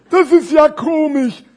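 high, loud, drawn-out wonder; exaggerated storybook puzzlement; puzzled fat scarf. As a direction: stretch it out like a baffled puppet. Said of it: "sagt der dicke Schal".

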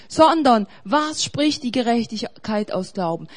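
ordinary talk

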